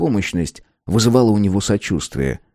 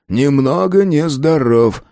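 slow, higher, lingering repeat; drawn-out musing; 4 semitones higher, slower than usual. Acted. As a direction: stretch out each word sceptically. Repeats them again, mulling.

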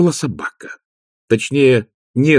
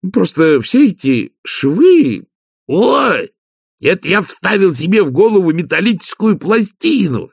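higher-pitched, lightly voiced Igor; Igor, eager servant; 10 semitones higher, 4 dB louder than usual. Igor explains the metal plate in his head, higher and earnest.

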